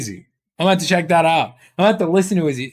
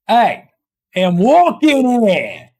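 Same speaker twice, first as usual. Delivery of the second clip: very stretched, higher mock New York accent; put-on New York accent; 4 semitones higher, 3 dB louder, much slower than usual. Trying to switch accents, in an overdone New York accent. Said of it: "I can't switch those accents".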